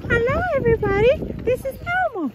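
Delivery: high, fast squeaky puppet voice; Elmo voice. Pretend. Vlogger trying Elmo for her viewers, high and fast. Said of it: "see if I can do Elmo voice".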